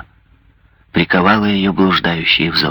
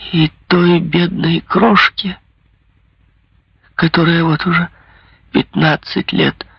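trembling voice; 8 semitones higher than usual. A narrator's reading. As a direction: voice faltering with grief. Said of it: "Она вся дрожала. Голос у неё прерывался".

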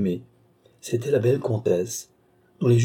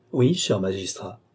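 ordinary delivery, nothing marked

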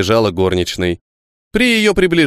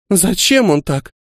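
sobbing; 8 semitones higher, slower than usual. A narrator's reading. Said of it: "всхлипнула Груша".

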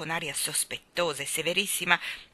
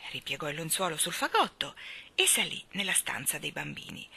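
usual speaking voice

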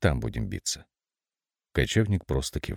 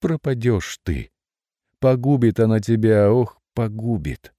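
tearful, slowing bird voice; almost sobbing, pleading; near sobbing; 6 semitones higher, 5 dB louder than usual. Acted. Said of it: "чуть не всхлипнув".

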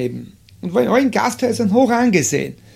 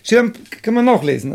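normal talking voice

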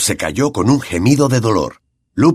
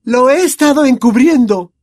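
put-on much higher and louder girl's voice, frantic; Hermione shouting; 6 dB louder than usual. A narrator's reading. Hermione yells at Lupin, much higher and louder. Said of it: "gritó Hermione".

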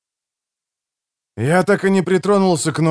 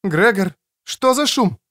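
high woman calling from the corridor; Креция's voice; 9 semitones higher than usual. Acted. Woman in the corridor asks about noise, high-pitched. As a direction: ask in a high female voice. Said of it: "донёсся из коридора голос Креции".

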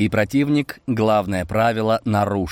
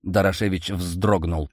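ordinary delivery, nothing marked

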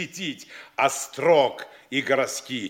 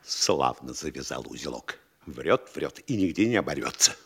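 very low, hushed private remark; sly aside; 13 semitones lower, 5 dB quieter than usual. Acted. Mayor privately marvelling at the guest's lies, sly aside. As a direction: drop right down, talking to yourself.

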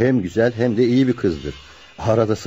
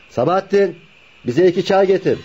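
raised voice, pitched up to carry across the shop; calling to the tea boy for two teas, raised voice; 8 semitones higher than usual. Acted. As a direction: call over to the tea boy.

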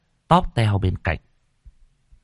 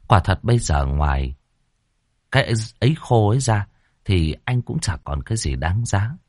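plain reading voice

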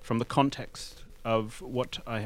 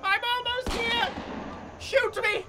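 high, loud desperate shouts under fire; frantic yelling; 9 dB louder than usual. Acted. Photographer yelling to soldiers to keep shooting, frantic shout. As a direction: scream it over the gunfire.

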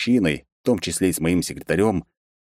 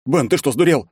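high, raised, incredulous shout; outraged protest; 9 semitones higher, 4 dB louder than usual. A narrator's reading. Boss just struck by his secretary, outraged. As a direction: demand it in pained outrage. Just been hit, yells at Ben.

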